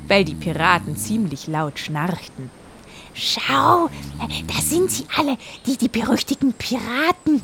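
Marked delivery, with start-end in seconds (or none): 3.1-7.4 parrot voice. Acted. Parrot character voice.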